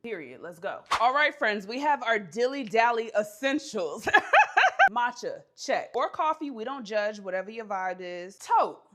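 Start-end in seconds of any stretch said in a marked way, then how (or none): 4.1-4.9 loud, high burst of giggles; real laugh. Real, not acted. Woman showing friends her dilly dally essentials, laughing. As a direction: crack up mid-announcement.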